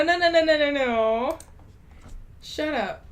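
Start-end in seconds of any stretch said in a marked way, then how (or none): none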